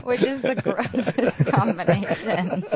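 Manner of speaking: slow, laughing disgust. Real, not acted. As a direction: laugh through the words, slowly. Laughs about gross makeup.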